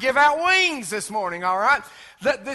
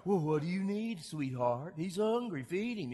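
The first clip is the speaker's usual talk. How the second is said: soothing parent; 3 semitones lower, 10 dB quieter than usual. Retold or imitated. Parent answering crying baby.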